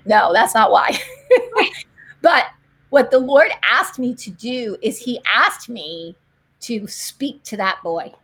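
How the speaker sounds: usual speaking voice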